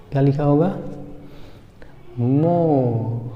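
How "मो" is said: मो drawn out with no r at the end; British 'more' as मो, r dropped; silent final r. Shown as a demonstration. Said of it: "यहाँ आर silent होता है". (मो को बिना र के खींचें)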